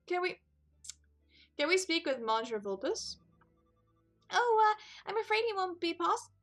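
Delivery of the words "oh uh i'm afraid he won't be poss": high, squeaky little mouse voice; a tiny mouse greeting customers; squeaky mouse voice, much higher; high-pitched Mousie. Acted. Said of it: "Who had a very high-pitched voice".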